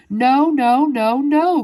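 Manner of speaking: high, loud, urgent cry; alarmed cry. A narrator's reading. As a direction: cry out to stop him. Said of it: "cried Mister Wonka".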